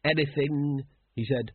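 groping for the word, unsure; tentative captain, thinking as he speaks; hesitant. A narrator's reading. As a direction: feel your way to a vague answer. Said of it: "Vimes hesitated. He wasn't exactly sure".

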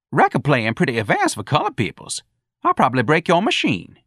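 high, animated comic character voice; high whoops between taunting threats; Jones, gleefully defiant. A narrator's reading. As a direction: crow over catching the boss out, pitched high.

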